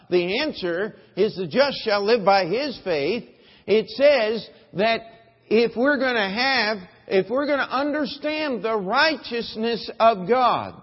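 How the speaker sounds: loud, ringing pulpit voice; full-force preaching. Acted. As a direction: preach it out to the whole congregation.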